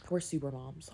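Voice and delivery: ordinary talk, nothing marked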